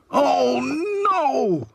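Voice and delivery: high, loud anguished moan. A narrator's reading. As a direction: wail at the mess. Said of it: "he moaned".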